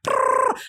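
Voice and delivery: rolled, motor-like brrr; trilled chase noise. Retold or imitated. Storyteller imitating four dogs in pursuit, trilled noise. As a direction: trill it like something speeding off.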